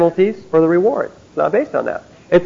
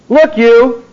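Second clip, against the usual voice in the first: mock street preacher; 6 semitones higher, 4 dB louder than usual. Mock preacher confronting a passer-by.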